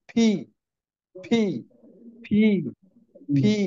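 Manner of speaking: "ফি" with air bursting from the lips; aspirated P. Shown as a demonstration. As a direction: puff out "ফি" from between both lips. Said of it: "দুই ঠোঁটের মাঝখান থেকে ফি উচ্চারণ হবে". ("ফি" দুই ঠোঁটের মাঝ থেকে বাতাস ছেড়ে)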